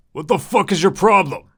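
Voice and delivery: loud, high, impatient waiter shouting; angry yell. Acted. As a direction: bark it at him, fed up. Impatient waiter yelling at Preston.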